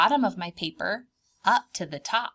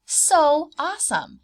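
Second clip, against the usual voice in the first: excited praise; 5 semitones higher, 7 dB louder than usual. Acted. Teacher praising the scribbles.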